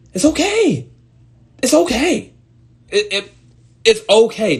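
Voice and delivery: high-pitched, insistent comforting; insistent reassurance. Acted. Telling anxious people they're fine, high and insistent.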